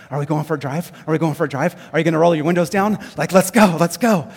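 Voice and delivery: loud, breathless puppy excitement building to the end; excited dog voice. Pretend. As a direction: gush like a dog that can't wait for the car ride. Dog excited to ride in car.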